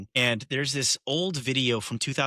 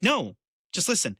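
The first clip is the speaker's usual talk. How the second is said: insistent wife; 7 semitones higher than usual. Retold or imitated. Wife voiced in retold video.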